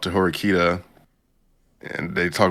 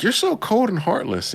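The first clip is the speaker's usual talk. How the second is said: very high, louder hurt accusation; mock offended girl; 3 dB louder than usual. Horikita scolding Ayano Koji, mock shrill and high. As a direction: squeal it like a wounded schoolgirl.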